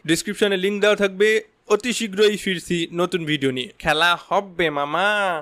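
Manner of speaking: upbeat promo voice for subscribers; channel outro read. Acted. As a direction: sell the channel with energy.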